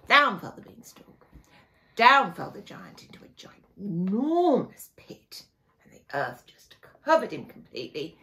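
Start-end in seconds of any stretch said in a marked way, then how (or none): none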